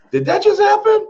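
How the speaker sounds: can't believe the deal closed that fast; stunned disbelief. Retold or imitated. After hanging up with the seller, high stunned disbelief. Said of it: "I hung up the phone and I went".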